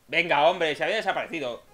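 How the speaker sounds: loud exasperated complaint; frustrated shout. Real, not acted. Queja fuerte al perder. (grito de frustración)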